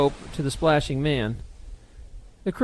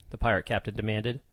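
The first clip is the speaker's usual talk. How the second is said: ordinary delivery, nothing marked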